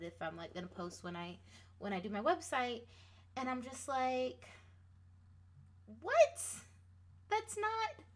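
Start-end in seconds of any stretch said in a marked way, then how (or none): 6.0-8.0 loud, high-pitched disbelief, acted out; incredulous. Reacting to the math on her creative hours, mock incredulous. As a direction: blurt a shocked high "What", then protest. Acts out shock at own tally.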